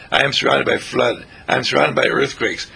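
ordinary talk, nothing marked

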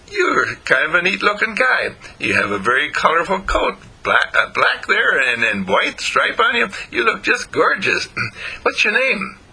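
high-pitched, admiring dog voice; chatty, admiring storybook dog; friendly puppy dog. A narrator's reading. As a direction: compliment a new acquaintance in a high puppy voice.

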